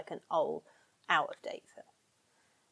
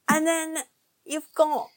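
usual speaking voice

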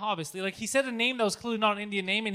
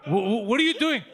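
mock taken aback; 3 semitones higher, 8 dB louder than usual. Acting out offended customer.

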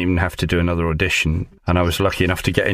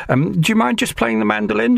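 much higher, a light mock woman's voice; imitating the casting director. Actor imitating the casting director asking him to play. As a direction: lift into her voice, politely asking.

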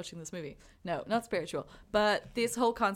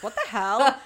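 loud, higher burst of mock disbelief; baffled outburst; 4 semitones higher, 11 dB louder than usual. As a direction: blurt it out, loudly incredulous. Acting out her baffled first reaction.

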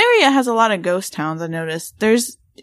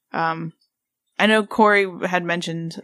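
ordinary talk

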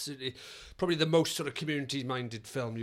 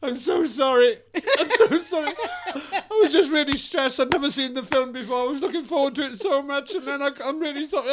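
much higher, louder, hammed-up tearful apology; gushing apology; 12 semitones higher, 6 dB louder than usual. Angry man apologises to the girl in tears, hammed-up gushing sorry. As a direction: overdo the remorseful sorry. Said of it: "I go".

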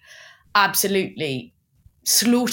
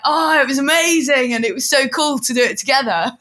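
high, loud mock-rapturous look back; gushing hindsight; 5 semitones higher, 5 dB louder than usual. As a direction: gush as if all pain is forgotten. Retold rosy look back.

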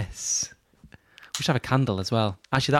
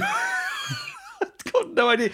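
high slow laughing reply; laughing squeal; much slower than usual. Real, not acted. Laughing at question.

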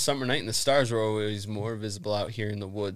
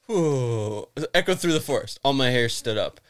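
ordinary talk

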